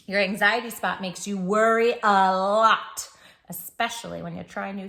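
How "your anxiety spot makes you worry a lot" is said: ordinary delivery, nothing marked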